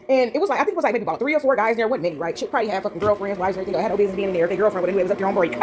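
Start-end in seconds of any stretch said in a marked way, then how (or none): none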